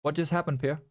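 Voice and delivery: usual speaking voice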